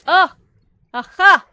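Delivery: sudden loud high cry of discovery; excited shout. Real, not acted. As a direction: yell out as it clicks.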